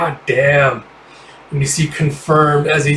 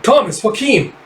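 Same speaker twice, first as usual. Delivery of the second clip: much higher urgent call; calling out names; 8 semitones higher than usual. Acted. Sonya calling Thomas and Joaquin in battle, high shout. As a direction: yell for your teammates. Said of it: "It's like".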